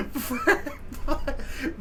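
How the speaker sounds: laughing too hard to finish; high, broken words through laughter; real helpless laughter. Real, not acted. Cracking up at the punchline.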